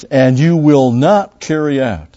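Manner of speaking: slow stressed scripture; emphatic quote. Acted. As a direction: press each word slowly.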